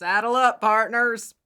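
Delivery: hollered Texan cowboy call to ride out; Texan accent. Pretend. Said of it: "now have a Texan accent".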